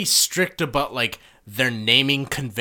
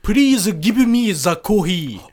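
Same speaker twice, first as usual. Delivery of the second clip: slow, loud, higher mock Japanese accent; hammed-up Japanese-accented English; caricature Japanese accent; 6 semitones higher, 6 dB louder, much slower than usual. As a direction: exaggerate every katakana syllable, Japanese accent. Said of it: "saying it in a racist accent".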